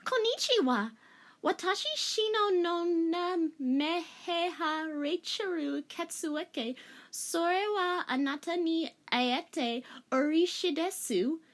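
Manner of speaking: high, slow, clumsy Japanese; overly careful wrong Japanese; butchered Japanese. Acted. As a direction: mangle the Japanese on purpose, very high. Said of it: "I'm about to horribly butcher this part".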